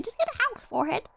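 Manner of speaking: high-pitched, hurried rerun of the phrase. Pretend. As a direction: repeat it high and fast. Repeats the phrase another way.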